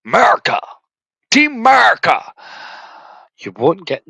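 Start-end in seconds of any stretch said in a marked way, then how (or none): 0.1-2.3 high mock cheer, much louder; patriotic shout. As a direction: bellow it like a parody anthem.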